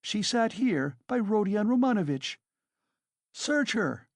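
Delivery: frantic railing. A narrator's reading. Katerina rails at landlady.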